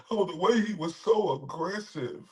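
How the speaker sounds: soft, mocking quote; mocking imitation of the woman. Streamer mocking the accuser, soft sneer. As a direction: sneer her words quietly.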